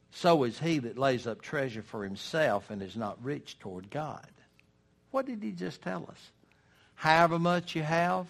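ordinary talk

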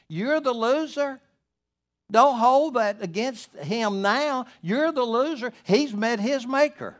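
high, loud pulpit exhortation, pressing hard; fervent preaching. Acted. Preacher urging a grieving man to forgive his late father, high and loud. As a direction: hammer the point home to the congregation.

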